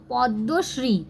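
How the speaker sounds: "पद्मश्री" as pod-do-shree, doubled d; silent m. Shown as a demonstration. Teacher reads it for learners, "पद्मश्री" said pod-do-shree. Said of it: "ये पद्म पढ़ेंगे और ये श्री".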